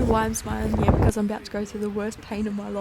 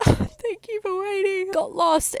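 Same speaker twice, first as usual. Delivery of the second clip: 1 sheepish thanks; 9 semitones higher, 4 dB louder than usual. Retold or imitated. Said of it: "And I was like".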